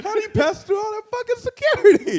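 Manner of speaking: high incredulous yell; shocked outburst. Retold or imitated. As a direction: shriek it in amazed outrage. Retelling shock at intruder.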